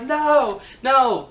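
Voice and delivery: hammed-up panic in a submission hold; panicked refusal. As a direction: act out a trapped wrestler pleading. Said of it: "she's like".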